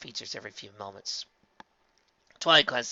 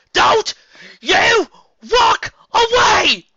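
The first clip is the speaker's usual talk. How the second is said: crying shout; 16 dB louder, much slower than usual. A narrator's reading. Tearful desperate shout.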